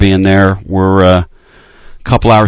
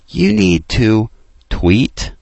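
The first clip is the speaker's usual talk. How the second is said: ordinary talk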